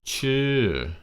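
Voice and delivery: "Chư" starts high and falls sharply; high falling tone on "Chư". Shown as a demonstration. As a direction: bring "Chư" up then drop it down. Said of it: "Bring it up and bring it down".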